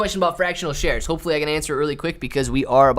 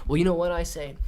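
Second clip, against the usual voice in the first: ordinary talk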